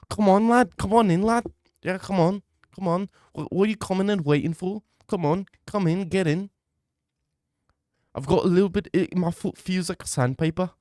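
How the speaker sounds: high-pitched mock Liverpool voice, coaxing; mock Molly McCann.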